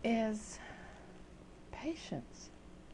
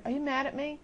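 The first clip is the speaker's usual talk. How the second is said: mock needy whine; 7 semitones higher than usual. Mock impatient IM messages.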